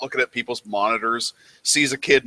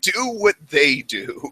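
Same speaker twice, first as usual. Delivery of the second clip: mock patient teacher; 7 semitones higher, slower than usual. Slow mocking teacher answer.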